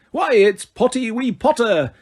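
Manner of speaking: high, slow, gleeful poltergeist taunt; teasing Harry in the corridor, cackling; cackling Peeves. A narrator's reading. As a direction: cackle it out mockingly. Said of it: "cackled Peeves".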